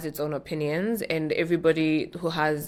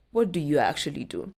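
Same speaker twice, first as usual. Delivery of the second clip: pointed, probing. Acted. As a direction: probe for status, stressing actually. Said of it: "So it's not like".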